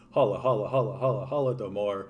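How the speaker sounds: soft looping "holla, holla" leading into Holodomor; repetitive pronunciation drill. Real, not acted. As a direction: loop the first half, then finish Holodomor as "hollo-doe-mor". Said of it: "can never fucking pronounce this correctly".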